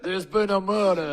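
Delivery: hammed-up Savannah accent, loud and slow; Andy Bernard. Playing along with co-host's teasing, exaggerated Savannah accent. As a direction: drawl it high and slow like a bad Southern mystery actor. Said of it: "Andy Bernard doing a Savannah accent".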